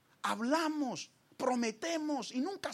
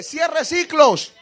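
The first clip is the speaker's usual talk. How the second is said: much louder, slow, emphatic calls; shouted preaching; 6 semitones higher, 19 dB louder, slower than usual. Acted. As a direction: drive each command home at full voice. Shouted exhortation to congregation.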